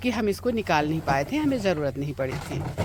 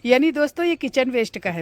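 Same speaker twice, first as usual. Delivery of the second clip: eager explaining; 6 semitones higher, 5 dB louder, faster than usual. Real, not acted.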